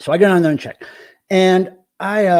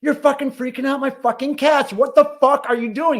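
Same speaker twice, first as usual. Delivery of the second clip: retold angry yell; 10 semitones higher than usual. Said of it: "said "fuck" ... really loud".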